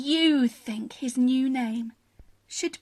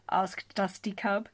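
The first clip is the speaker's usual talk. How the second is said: usual speaking voice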